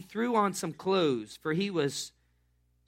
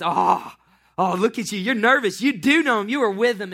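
loud, higher mocking taunt; girl loudly mocking a nervous fisherman; taunting accusation; 4 semitones higher, 10 dB louder than usual. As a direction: needle him, louder and louder.

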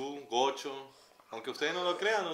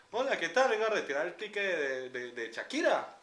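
imitating a customer's Andean gocho accent, higher; 5 semitones higher than usual. Customer from Táchira at the ticket desk, in Andean accent. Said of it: "llegaba a retirar el ticket y decía".

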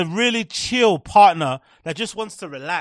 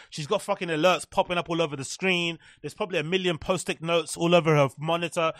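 normal talking voice

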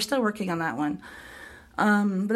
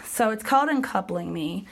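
ordinary delivery, nothing marked

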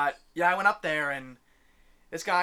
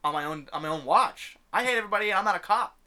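worked-up rant; 5 semitones higher, 6 dB louder than usual. Retold or imitated. Ranting back at preachy guy.

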